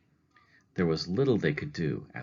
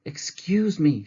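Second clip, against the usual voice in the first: much higher, slow anxious plea; Mrs. Prim's voice; 11 semitones higher, 5 dB louder, slower than usual. Acted. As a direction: stop a stranger, worried. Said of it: "she said to a man".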